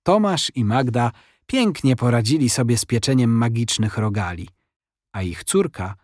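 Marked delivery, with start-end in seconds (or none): none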